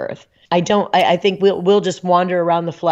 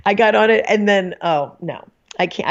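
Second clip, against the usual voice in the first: ordinary talk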